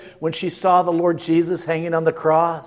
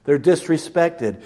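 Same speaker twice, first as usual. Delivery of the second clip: ordinary talk, nothing marked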